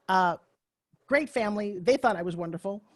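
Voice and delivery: ordinary delivery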